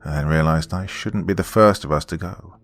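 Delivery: ordinary delivery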